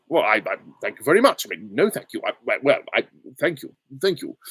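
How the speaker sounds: stammering, blustering thanks with jumping pitch; flustered, stuttering politician voice; Boris Johnson. Acted. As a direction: bluster and stumble over your words. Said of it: "Good Boris Johnson impression".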